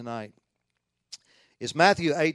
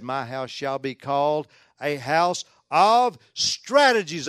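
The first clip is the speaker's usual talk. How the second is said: drawn-out, louder, tongue-in-cheek scripture quote; mock proclamation; 2 semitones higher, 8 dB louder, slower than usual. Preacher misquoting Jesus to tease the church, mock-grand proclamation. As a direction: stretch out the wrong word with mock grandeur.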